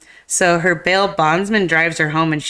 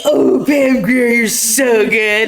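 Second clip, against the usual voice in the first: higher, slow, swooning praise; gushing admiration; 5 semitones higher, 4 dB louder, slower than usual. Retold or imitated. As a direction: melt over her, drawn out. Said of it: "And I was like".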